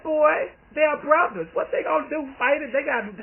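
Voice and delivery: put-on high, loud, amused old aunt; aunt laughing at her nephew, high and loud; aunt's tickled retort. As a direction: scold him with amused disbelief, pitched high and loud. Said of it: "She said".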